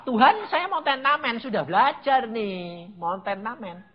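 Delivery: high opening plea, sinking away; mock pleading prayer. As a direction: plead like a student reporting to God. Memerankan doa mengeluh kepada Tuhan. (memohon setengah mengeluh)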